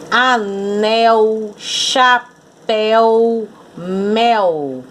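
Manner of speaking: Anel, chapéu, mel word by word, drawn out; rhyme demonstration. Shown as a demonstration. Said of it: "Todas as três rimam".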